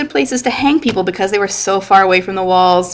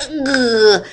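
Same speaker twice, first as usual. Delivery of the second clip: a short whiny groan of dismay; mock groan; 4 semitones higher than usual. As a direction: groan like a student who missed the question. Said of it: "And I'm like".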